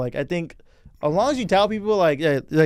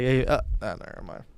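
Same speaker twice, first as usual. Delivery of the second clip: mock mumble; 5 semitones lower, 5 dB quieter than usual. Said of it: "he's like".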